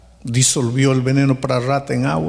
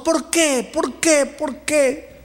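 high, pleading repeated cry; imitated anguished why; 11 semitones higher, much slower than usual. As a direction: act out her anguish in a high voice. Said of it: "Y ella comenzó".

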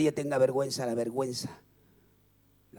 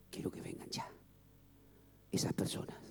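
whisper; 5 semitones lower, 9 dB quieter than usual. Real, not acted. Whispered altar call.

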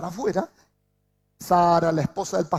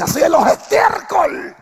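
commanding shout; 9 semitones higher, 10 dB louder, slower than usual. Acted. Quoting the psalm as a command.

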